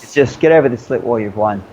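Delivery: normal talking voice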